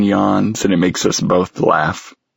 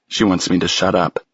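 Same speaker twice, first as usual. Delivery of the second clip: ordinary delivery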